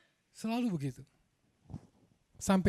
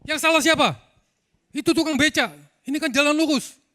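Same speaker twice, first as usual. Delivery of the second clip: very high, loud barked challenge; fierce shout; 10 semitones higher, 8 dB louder than usual. Retold or imitated. Cornered teen yelling back at the angry mob, fierce shout. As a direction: bellow it to freeze the crowd. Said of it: "bentakan itu".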